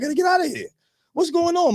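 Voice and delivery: high, rattled panic; frantic high-pitched panic; panicked. Retold or imitated. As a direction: voice jumps up in alarm. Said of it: "I was like".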